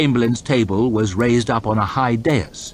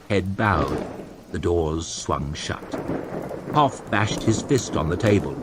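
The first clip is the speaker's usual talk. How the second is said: ordinary delivery, nothing marked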